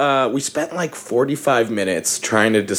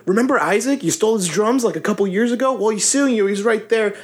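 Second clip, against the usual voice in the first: high, gloating reveal; mock-sweet gloating; gleeful gotcha; 9 semitones higher than usual. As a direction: rub it in cheerfully. Telling the guy he's sued.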